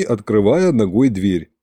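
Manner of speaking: ordinary delivery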